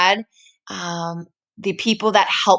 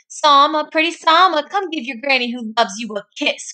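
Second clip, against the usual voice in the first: granny calling; 7 semitones higher than usual. Acted. Granny calling to Salma to come kiss her, high and sweet. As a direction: sing out to your granddaughter, higher. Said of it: "cried Granny".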